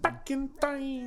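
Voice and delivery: time-travel echo, slow and floaty; dreamy echo. Pretend.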